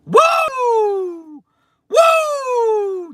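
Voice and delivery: very high, loud whooping; real joyous woo shouts; excited whoops. Real, not acted. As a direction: cheer at the top of the voice. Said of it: "I got so excited".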